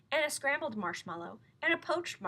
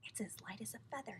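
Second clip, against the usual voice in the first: unvoiced, hushed whisper; 10 dB quieter than usual. A narrator's reading. Lion whispering over his marshmallow. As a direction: breathe it in awe.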